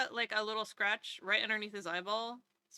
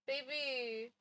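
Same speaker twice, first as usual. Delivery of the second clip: ordinary talk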